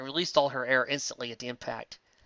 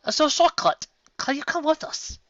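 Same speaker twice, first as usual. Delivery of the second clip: high character voice; 14 semitones higher, 4 dB louder than usual. Acted.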